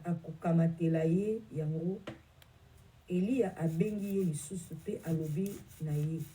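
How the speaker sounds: ordinary delivery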